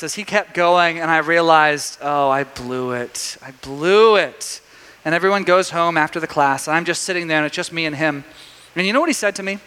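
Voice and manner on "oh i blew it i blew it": slow, drawn-out mock regret; embarrassed. Young teacher realizing he failed, slow and embarrassed. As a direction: drag it out as a sinking realization. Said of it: "I felt so embarrassed".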